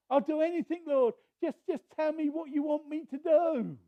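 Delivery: high desperate promise; clinging man begging instructions; frantic eagerness; eager pleading. Retold or imitated. Jack pleading with God.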